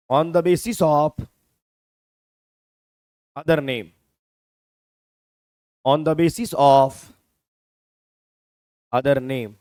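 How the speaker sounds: very slow, each word spaced out for note-taking; slow classroom dictation with long gaps. Shown as a demonstration. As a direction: dictate it slowly so students can write. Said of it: "teacher dictating definition slowly".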